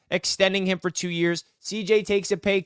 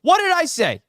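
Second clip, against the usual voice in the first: high gloating cry, much higher; 11 semitones higher than usual. Real, not acted. Host gloats at rival who was wrong, high excited crowing. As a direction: crow it at the rival.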